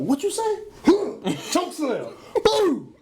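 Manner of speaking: bully the little brother, laughing; playful retold threat with a shout; mock tough challenge.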